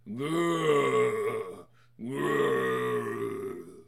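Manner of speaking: low growling werewolf. Acted. Werewolf growls at the couple. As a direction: growl like a beast.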